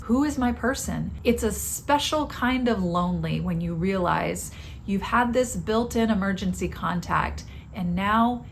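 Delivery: ordinary talk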